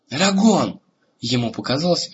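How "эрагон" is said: high, loud roar of the name; roaring his name. Acted. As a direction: roar it right into his ear. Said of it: "проревела это слово прямо ему в ухо". (рёв прямо в ухо)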